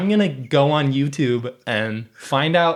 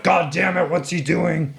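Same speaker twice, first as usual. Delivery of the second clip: higher-pitched mock outburst; exasperated police chief; 6 semitones higher than usual. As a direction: play the fuming chief, pitched up. Retold police chief, outraged.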